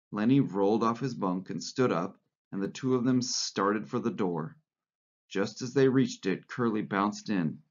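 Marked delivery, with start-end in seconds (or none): none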